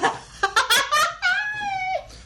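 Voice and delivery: shrieking laugh; high burst of laughter. Real, not acted. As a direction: break into a high cackle. Big laugh.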